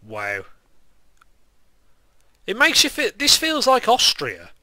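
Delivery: normal talking voice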